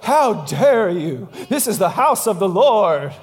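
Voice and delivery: slow, booming mock outrage; hammed-up righteous indignation. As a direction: play up a scandalized holy scolding. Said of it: "He's not there like".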